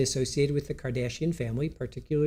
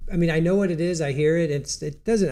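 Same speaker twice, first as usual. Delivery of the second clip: ordinary delivery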